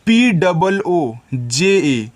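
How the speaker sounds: slow, letter by letter; spelling letters out. Shown as a demonstration. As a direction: spell each letter slowly for the children. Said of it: "teacher spells letters for children".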